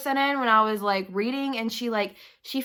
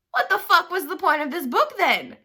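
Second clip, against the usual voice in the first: higher, louder exasperated what-the-hell; exasperated outburst; 8 semitones higher, 4 dB louder than usual. Retold or imitated. Reader venting at the book's twist ending, exasperated and higher. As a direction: throw up your hands at the pointless plot.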